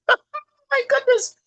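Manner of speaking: high, slow squeal of laughter through the words; real laughing disbelief, very high and drawn out; laughing amazement. Real, not acted. Laughing at the scripture line.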